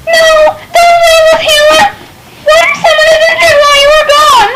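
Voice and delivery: high, pleading shout; panicked villager. Acted. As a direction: cry out in panic.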